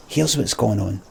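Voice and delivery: normal talking voice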